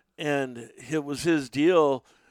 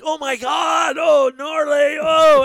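much higher, louder, hooting like he can't believe it; mock shocked yelling; 12 semitones higher, 10 dB louder, slower than usual. Friend in motorhome sees sewage spraying, yelling in shocked glee. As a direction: blurt it out between laughs, way up high.

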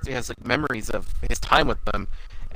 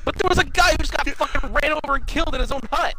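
much higher, indignant rant; outraged; 12 semitones higher than usual. Real, not acted. Recapping the cartoon to a friend, high outraged rant. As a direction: rant in disbelief, pitched way up.

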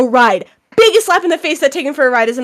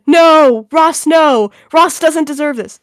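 loud, higher wail of outrage; hammed-up protest; 3 semitones higher, 5 dB louder, slower than usual. Fan protesting for Ross in chat, hammed-up anguish. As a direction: plead theatrically on someone's behalf.

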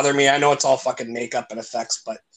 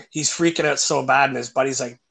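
ordinary delivery

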